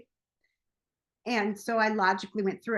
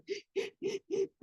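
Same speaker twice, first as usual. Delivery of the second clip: sobbing gasp; 12 semitones higher, 4 dB quieter than usual. Retold or imitated. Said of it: "my chest is like".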